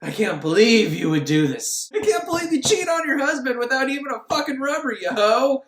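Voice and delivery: indignant mock telling-off; acted scolding. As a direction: scold with hammed-up disbelief. Acting out an outraged scolding.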